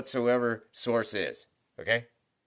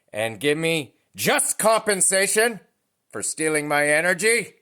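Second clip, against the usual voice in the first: loud, higher defiant shout, climbing to a peak at the end; yelling at the sky; 5 semitones higher, 6 dB louder than usual. Retold or imitated. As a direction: shout your refusal up to the sky. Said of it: "And I yelled out to the sky".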